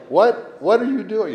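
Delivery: a high, incredulous what-are-you-doing; exasperated. Retold or imitated. Grandma's phrase illustrated, high exasperated question. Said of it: "It's like".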